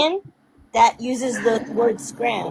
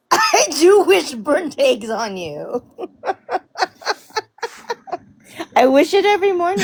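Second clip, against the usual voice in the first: laughing; 8 semitones higher than usual. Real, not acted. Laughing while cursing her.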